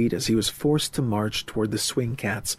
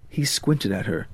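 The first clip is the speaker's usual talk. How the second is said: ordinary delivery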